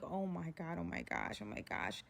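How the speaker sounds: very low, soft muttered panic; imitating quiet disbelief, dropping away; hushed dread. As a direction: sink into an under-the-breath oh-my-gosh. Retold silent dread as driver stares.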